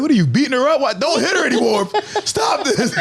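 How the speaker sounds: put-on high, laughing child plea; pleading kid. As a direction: beg innocently like a little boy. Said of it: "I'm like".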